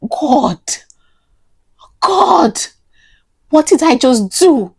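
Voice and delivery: high, pained cry to God; acted-out despair, voice leaping up; retold anguished wail. Crying alone in the bathroom, anguished. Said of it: "I was crying and wailing silently".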